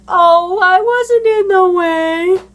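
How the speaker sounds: high, loud, drawn-out mock protest; mock victim. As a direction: mimic someone playing the innocent victim. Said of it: "themselves seem like the victim".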